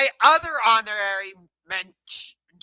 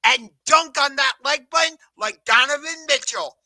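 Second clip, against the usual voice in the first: louder, punchy announcer read; hype intro line; 4 semitones higher, 5 dB louder than usual. Shown as a demonstration. Host belting his new intro catchphrase to viewers. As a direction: sell the catchphrase big. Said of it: "that's the intro I'm gonna go with".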